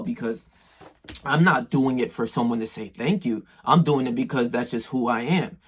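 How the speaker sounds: normal talking voice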